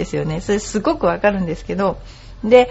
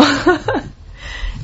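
amused laugh; 7 semitones higher than usual. Real, not acted. Laughs at own predicament.

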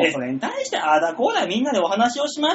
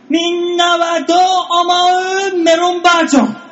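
title call; 8 semitones higher, 3 dB louder, slower than usual. Acted. Announcing the corner title.

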